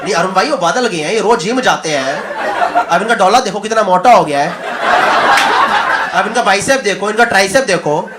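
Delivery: fast mock Urdu Punjabi hype; lightly done Punjabi commentator accent; Shoaib's accent. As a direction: show off Shami like Shoaib Akhtar. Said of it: "Urdu Punjabi accent".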